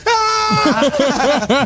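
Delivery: high yelp of panic; sudden high cry of terror; panicked scream. Pretend. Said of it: "I'd be sat there going".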